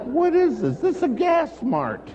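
incredulous. Retold or imitated. Speaker baffled by the crying in the gas mart, loud high disbelief. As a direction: cry out in bewilderment. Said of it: "And I'm like".

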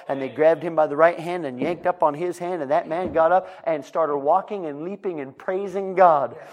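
high, loud preacher building excitement; excited preacher narrating a miracle; fiery storytelling preaching. Acted. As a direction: preach the story with rising fire. Preaching at full force, story.

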